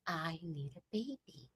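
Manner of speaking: soft, dead-calm demand. Retold or imitated. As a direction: say it quietly, ice calm. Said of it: "very calmly".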